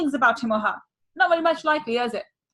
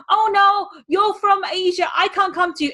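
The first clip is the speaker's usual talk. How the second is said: higher, mock voice of money turning you away; money as a character refusing you, hammed up; 6 semitones higher than usual. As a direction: play money saying no in a silly voice. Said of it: "money voiced refusing".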